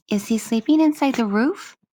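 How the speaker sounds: put-on high, bright child asking; a small girl wondering aloud; little girl voice. Child voice Lilica.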